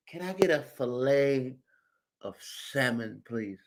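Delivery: low, soft, overly polite ordering; mock a shy customer asking for salmon; hushed, hesitant fish order; meek customer. Voices timid fish customer in line.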